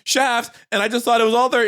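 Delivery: high, loud mock excuse; defensive boy. Boy excusing himself to grandma, high flustered protest.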